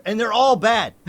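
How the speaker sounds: loud, high exclamation; emphatic rant. Real, not acted. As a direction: belt out the damning verdict. Ranting about British accents.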